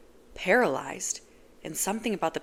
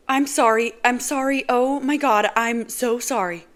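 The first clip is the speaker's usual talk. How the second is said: higher, louder frantic female voice; panicked apology; 6 semitones higher, 8 dB louder than usual. Acted.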